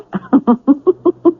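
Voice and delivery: a short scornful laugh; sneering laugh. Acted. Villain mocks the grieving husband with a scornful laugh. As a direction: laugh with contempt at the story. Villain mocking the dead father.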